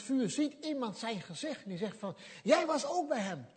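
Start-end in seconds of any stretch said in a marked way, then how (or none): 2.4-3.5 higher, louder accusation; a third bystander loudly accusing. Retold or imitated. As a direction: nail him with it.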